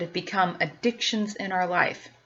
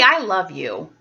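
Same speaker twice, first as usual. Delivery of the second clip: ordinary talk, nothing marked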